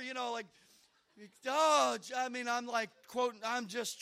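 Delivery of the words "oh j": ordinary delivery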